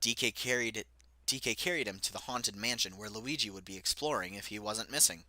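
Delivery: ordinary delivery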